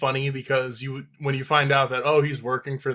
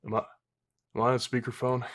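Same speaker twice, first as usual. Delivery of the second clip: halting, softer suspicious query; hesitant mock question; 2 semitones lower, 8 dB quieter, faster than usual. Imitating Mordecai interrupting his rant, hesitant question. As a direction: imitate someone tentatively checking, softly.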